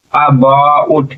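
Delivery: About slowly as अ, बा, उट; syllable by syllable. Shown as a demonstration. Teacher quizzing students on about, sounded out slowly. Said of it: "क्या हो जाएगा".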